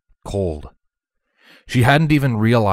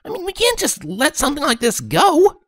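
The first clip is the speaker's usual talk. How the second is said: frowning tomboy. Acted.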